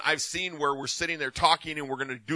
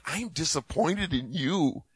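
slow realization; 5 dB quieter, slower than usual. Retold or imitated. Realization quoted slowly.

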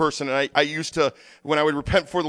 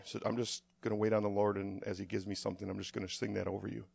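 low, very soft and reverent; hushed ministry talk; 5 semitones lower, 11 dB quieter than usual. Real, not acted. Pastor preparing to sing over the congregation, hushed and prayerful. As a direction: speak low and softly, waiting on the Lord. Hushed waiting before singing.

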